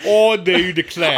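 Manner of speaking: put-on loud, hammy British accent; exaggerated English accent. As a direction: belt it out in mock Cockney. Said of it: "You keep doing an English".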